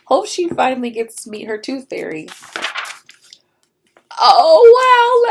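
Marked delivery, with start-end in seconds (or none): 4.2-5.3 amazed. Real, not acted. Reader amazed.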